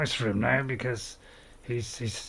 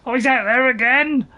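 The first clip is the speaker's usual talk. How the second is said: sudden loud shout, pitched up; 8 semitones higher, 7 dB louder than usual. Acted. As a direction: yell it out in alarm. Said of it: "if I am shouting".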